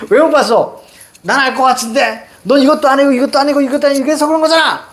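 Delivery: high, rapid-fire telling-off; badgering, high-pitched rant; nagging scolding. Acts scolding parent on the phone.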